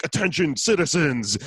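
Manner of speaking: lightly mocking police loudspeaker; a hint of robotic cop announcement; RoboCop voice. Host mimics police loudspeaker at protest, mock RoboCop voice. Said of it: "they brought out the RoboCop voice".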